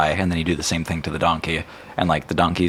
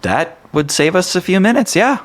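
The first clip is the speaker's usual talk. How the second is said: high offhand reply; 12 semitones higher, 4 dB louder than usual. Acted. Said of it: "shrug, and say".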